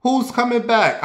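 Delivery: put-on high, loud puzzled old lady; confused granny. Confused grandma on the phone, high and loud. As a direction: sound lost, pitch way up. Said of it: "My grandma's on the phone".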